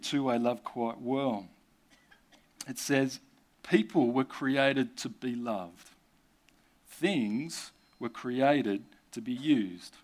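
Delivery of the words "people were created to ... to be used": slow, deliberate reading of a quote; slow solemn quote; slow quoting. Shown as a demonstration. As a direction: weigh each word slowly.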